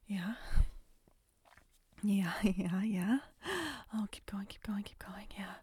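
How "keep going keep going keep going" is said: normal talking voice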